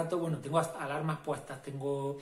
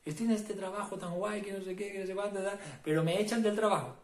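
higher, mock chatter of a worrying mind; lightly voiced fretting thoughts; anxious inner voice; 5 semitones higher than usual. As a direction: rattle on like a mind spiralling into worry. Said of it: "y de repente empiezas".

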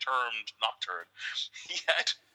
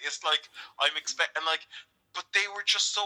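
normal talking voice

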